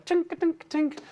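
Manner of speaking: high little tinkling sound effect; imitated clinking. Artie drops the grail, high clinking noises. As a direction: mimic metal bouncing on stone.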